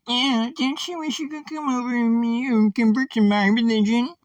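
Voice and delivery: very high squeaky put-on voice; mocking acquaintance's voice. Speaker demonstrating how horrible an acquaintance is, mocking squeaky voice. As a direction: plead like a ridiculous would-be converter. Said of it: "I use that voice".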